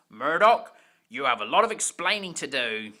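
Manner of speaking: loud, sharp scolding boss, then fast; cross. Acted. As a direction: bark his name and rattle off the rest quickly. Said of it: "he was cross".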